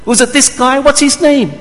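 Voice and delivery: high, loud jeering at a blindfolded prisoner; mocking taunt. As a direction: taunt him like a sneering guard. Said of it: "they began to mock him".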